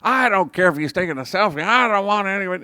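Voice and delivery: fast, high, loud mock tirade; ranting yell, imitated. Homeless man going off on Saul, imitated ranting yell. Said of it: "the guy who was screaming at him".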